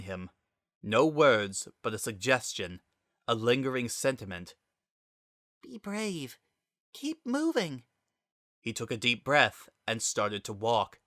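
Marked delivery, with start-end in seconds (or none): 5.6-7.8 high, hushed, barely-there encouragement; a soft whisper of courage inside the mind; gentle inner voice. A narrator's reading. Azrael's lingering presence urges Frisk on, high and hushed.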